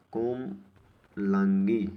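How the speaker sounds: कोमलांगी very slowly, in two held parts; drawn-out demonstration. Shown as a demonstration. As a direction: break it as कोम... Langi, stretched out. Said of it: "ऐसे".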